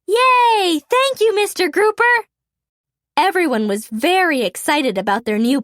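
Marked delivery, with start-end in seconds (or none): 0.1-2.1 high, bright cheer of thanks. A narrator's reading. The Bubble Guppies cheering their teacher, excited. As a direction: cheer like a class of excited kids. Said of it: "All the Bubble Guppies cheered".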